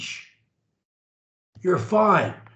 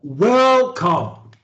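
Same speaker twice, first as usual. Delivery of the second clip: start up high; 5 dB louder than usual. Shown as a demonstration. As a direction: WELCOME with a loud, high first syllable, then falling. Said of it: "we go out of our voice register".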